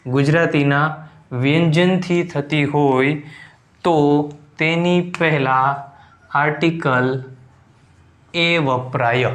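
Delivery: slow, drawn-out word-by-word delivery; slow dictation. Shown as a demonstration. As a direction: dictate the rule slowly, pausing between phrases. Dictating the rule for writing down. (ધીમે ધીમે લખાવતા હોય એમ)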